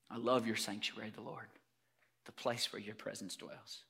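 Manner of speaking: low, hushed and reverent; boy's prayer. Acted. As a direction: confide it softly to God. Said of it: "what the little boy David would say to his God".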